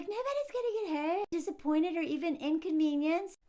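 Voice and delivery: chipper daughter. Retold or imitated. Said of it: "She's like".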